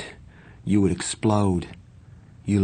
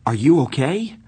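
high, louder worried question; alarmed; 6 semitones higher, 5 dB louder than usual. A narrator's reading. Narrator checking on pale Johnny, alarmed. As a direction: pitch it up in sudden concern. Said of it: "I said, alarmed".

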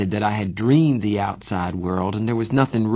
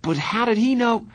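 high-pitched, puzzled question; bewildered; 13 semitones higher than usual. A narrator's reading. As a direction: ask it, thoroughly baffled. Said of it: "I said, bewildered".

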